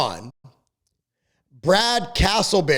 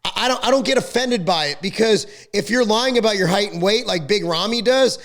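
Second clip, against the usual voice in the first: normal talking voice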